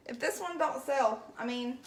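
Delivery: ordinary delivery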